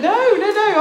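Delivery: high, loud rush of no's; insisting to the stranger that the cake comes with no catch; eager protest. Retold or imitated. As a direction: wave off the idea of a catch.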